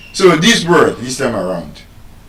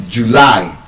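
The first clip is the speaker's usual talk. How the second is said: July as joo-LAI, lower and drawn out; stress on second syllable; 4 semitones lower than usual. Shown as a demonstration. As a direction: lean slowly on the end of July. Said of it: "The stress is on the second syllable".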